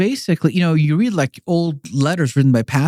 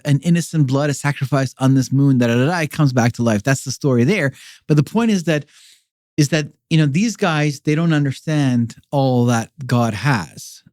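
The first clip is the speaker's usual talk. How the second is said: ordinary delivery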